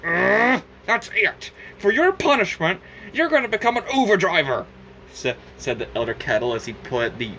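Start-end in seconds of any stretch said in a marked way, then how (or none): 0.0-4.6 angry sentence. Acted. Elder Kettle punishing Cuphead, fed-up shout. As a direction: lay down the punishment.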